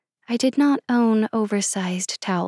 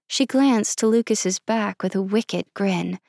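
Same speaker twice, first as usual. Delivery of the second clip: plain reading voice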